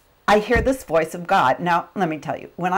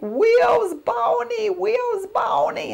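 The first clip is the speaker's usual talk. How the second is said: put-on high, slow toddler voice with R trouble; a small child begging for Barney; high, slow little girl asking for her show; little daughter's voice; much slower than usual. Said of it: "she's like".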